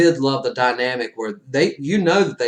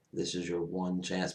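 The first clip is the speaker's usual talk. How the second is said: much softer, low and grave; hushed quote; 5 semitones lower, 13 dB quieter than usual. Retold or imitated. Doctor Strange quietly warning Tony, hushed and grave. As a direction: say it like a secret warning. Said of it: "Doctor Strange goes".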